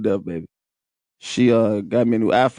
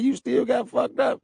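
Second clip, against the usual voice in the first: lightly voiced uncle, higher and teasing; 12 semitones higher than usual. Uncle ribbing injured nephew, higher teasing voice. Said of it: "He said".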